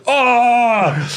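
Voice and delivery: long, high awestruck "aah"; imitated cry of amazement, held high; open-mouthed "aah". Retelling the passenger's awe, high open-mouthed "aah". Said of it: "it hangs open for five seconds".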